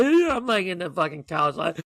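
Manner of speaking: high, loud, hammed-up wailing; emotional wail. Viewer reliving the goodbye on the couch, mock emotional wail. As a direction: act out falling apart on the couch. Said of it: "I'm getting emotional".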